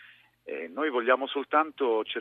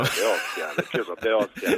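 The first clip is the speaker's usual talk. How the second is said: put-on playful thick Roman accent; 3 semitones higher, 5 dB louder than usual. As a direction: lay on the Ostia Roman dialect. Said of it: "cioè la voce di Roma". (accento romano scherzoso)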